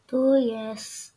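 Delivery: normal talking voice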